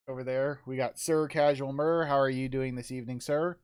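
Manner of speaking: normal talking voice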